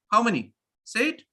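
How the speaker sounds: ordinary talk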